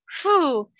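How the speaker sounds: loud dismissive puff of air; scoffing phew. A narrator's reading. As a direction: blow out a mocking phew. Starfish scoffs at seahorse.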